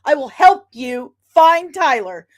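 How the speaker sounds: high, loud, emphatic pledge; retold urgent reassurance. Acting out eager offer to help.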